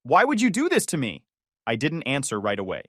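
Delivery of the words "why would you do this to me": high, loud demanding cry; shouted accusation, pitch up; angry plea. A narrator's reading. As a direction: throw the blame at him. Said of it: "she shouted".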